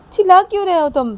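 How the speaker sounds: loud, high, fast retort; shouting back. Acted. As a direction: fire it straight back at him. Wife snaps back at shouting husband. (ऊँची आवाज़ में तेज़ पलटकर जवाब)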